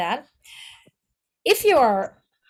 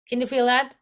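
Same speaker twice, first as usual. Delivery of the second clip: ordinary delivery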